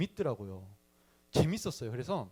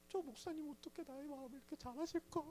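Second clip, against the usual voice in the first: high, soft marvelling; much higher and softer, touched and amazed; amazed wondering; 12 semitones higher, 11 dB quieter than usual. Retold or imitated. Boy marvelling that the pastor knows his heart, high and soft.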